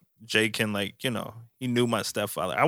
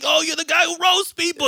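high squeaky impression of a fan spotting him; mock fan voice; 4 dB louder than usual. Friend imitating a fan spotting him on the balcony, squeaky. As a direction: squeal it like an excited stranger. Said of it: "be like".

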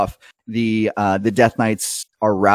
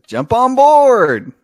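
much higher, louder, slow call; high driver's cheerful invite; high folksy invite; 12 semitones higher, 6 dB louder, slower than usual. Acted. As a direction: wave them aboard cheerfully.

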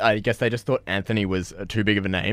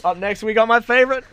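excited intro. Real, not acted.

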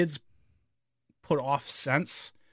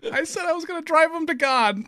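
laughing while telling; 13 semitones higher, 3 dB louder than usual. Retold or imitated. Retells answering wife, laughing.

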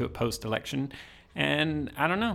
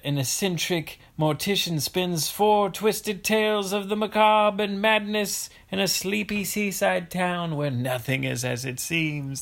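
dramatic tagline read; 5 semitones higher than usual. Shown as a demonstration. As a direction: read it like a horror trailer. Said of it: "That's the tagline".

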